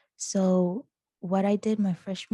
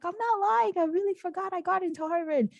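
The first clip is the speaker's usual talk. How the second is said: high-pitched, pleading retold insistence; insistent protest; 11 semitones higher, faster than usual. Insisting to sister she got into Harvard, acted protest. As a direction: protest your innocence in a raised pitch.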